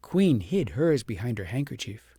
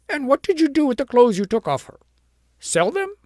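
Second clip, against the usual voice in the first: higher, louder, scornful; sharp interrogation; 12 semitones higher, 7 dB louder than usual. A narrator's reading. Queen demands answers from the shepherdess, high and scornful. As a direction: pin her down with scorn.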